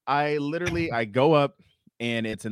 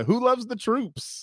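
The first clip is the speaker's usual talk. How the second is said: high, slow rallying call; mock crowd pandering; 13 semitones higher, slower than usual. Failing comic appealing to the corporate crowd, mock-peppy high call. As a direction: fish desperately for applause.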